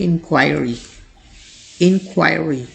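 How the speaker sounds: इंक्वायरी with stress on KWAI; correct pronunciation. Shown as a demonstration. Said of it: "correct pronunciation होती है".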